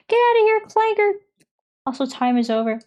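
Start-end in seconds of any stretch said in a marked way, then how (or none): 0.1-1.1 high mock jeer; jeering robot heckler. Robot heckler jeers at another robot, high mock voice. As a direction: sneer it at the other robot, pitched well up. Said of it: "robots are being racist against the other robots".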